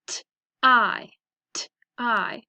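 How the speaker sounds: "tie" as t, gap, long i; segmented phonics "tie"; sounded out. Shown as a demonstration. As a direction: sound out "tie" twice with gaps. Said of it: "So let's practice them".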